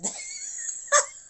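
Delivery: breathy high-pitched giggle; real giggle breaking through; high laugh. Real, not acted. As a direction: laugh helplessly at the spirit's antics. Spirit making her laugh.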